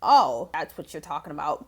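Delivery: low, dawning realization; the penny drops, voice low; realizing. Retold or imitated. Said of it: "I'm like".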